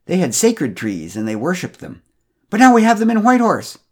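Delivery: condescending. A narrator's reading. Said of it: "somewhat condescendingly".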